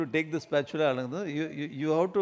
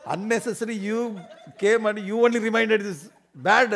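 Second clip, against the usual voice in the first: indignant scolding; 5 semitones higher, 6 dB louder than usual. Acted. Wife scolds husband back.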